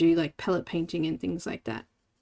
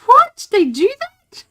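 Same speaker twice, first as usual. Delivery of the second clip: loud, very high imitation of a stunned mum; mock shock; 15 dB louder, slower than usual. Mum learning how the scam works, imitated shock. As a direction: mimic her astonishment, voice shooting up.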